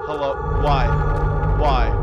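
panicked calling. Real, not acted.

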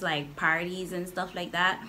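normal talking voice

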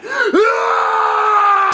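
long, high scream of rage; drawn-out mad "Ah!", pitched high; angry power-up yell. Shown as a demonstration. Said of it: "get really mad".

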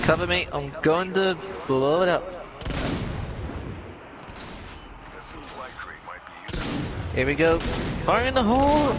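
rising to a yell at the blast; cover me shout. Real, not acted. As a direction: call it out to the team. Calling out before detonation.

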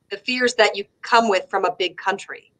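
ordinary talk

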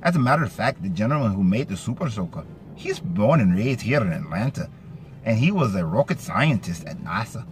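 lilting Scottish-Irish brogue, pitch swinging up and down; Scottish-Irish accent. Shown as a demonstration. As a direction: slip into a sing-song Scots-Irish accent. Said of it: "get into my more kind of a Scottish, Irish accent".

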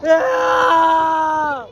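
high thrilled whoops; on a roller coaster. Real, not acted. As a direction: whoop with joy mid-ride. Cheering on the ride. (Jubelschreie auf der Achterbahn)

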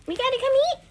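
high, loud put-on reply; protesting question. Called to the table, protesting question. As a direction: ask it high and loud, rising at the end. Called figure answers cook in play.

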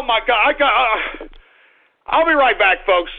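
normal talking voice

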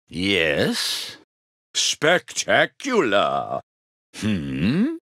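usual speaking voice

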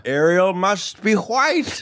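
put-on high, slow prissy kid voice; hammed-up child voice; mocking child voice. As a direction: play a snooty six-year-old. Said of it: "And the kids were like".

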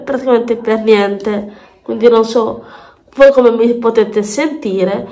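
ordinary talk, nothing marked

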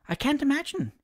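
high-pitched, pent-up vexation; reply with vexation, pitched well up; vexed. A narrator's reading. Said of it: "bursting with vexation".

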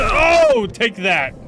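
loud, high battle cry as he lands a hit; excited shout. Real, not acted. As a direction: yell it while smashing the enemy. Punching enemy in game.

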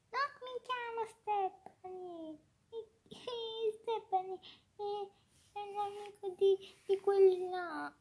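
high, soft tearful whine; pretend crying. Crying girl denies being Stephanie, soft high mock sobbing. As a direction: whimper the words as if crying. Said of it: "Stephanie sta piangendo".

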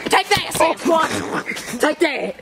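mock battle cry. Attacking imaginary Sasquatch.

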